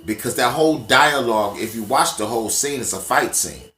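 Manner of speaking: ordinary talk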